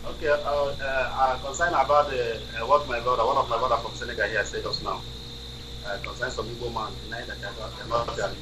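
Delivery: faint, distant phone voice; very soft, almost lost; barely audible. Real, not acted. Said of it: "your voice is not high enough".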